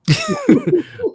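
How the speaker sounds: squeaky high burst of laughter; a sudden high-pitched giggle. Real, not acted. Laughing at being burnt out.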